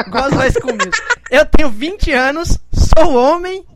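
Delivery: exaggerated wounded pride; mock outrage. Real, not acted.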